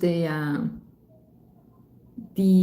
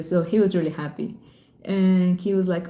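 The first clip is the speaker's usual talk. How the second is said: ordinary delivery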